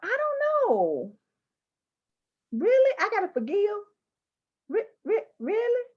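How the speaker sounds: incredulous reaction. Retold or imitated. Reacting to the book's advice in a high disbelieving voice. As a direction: squeak out the doubt. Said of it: "I'm like".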